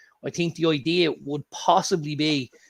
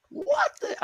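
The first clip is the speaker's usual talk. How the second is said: a mock gasp of disbelief, far higher; shocked at the price. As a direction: react to the bill like it's a robbery. Shocked at the drinks bill.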